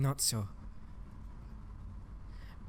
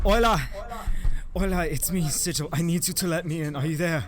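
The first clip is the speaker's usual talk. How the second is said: loud, urgent calling; calling out loudly; 5 semitones higher, 7 dB louder than usual. Acted. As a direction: shout her name through the door. Pounding on shop door, calling.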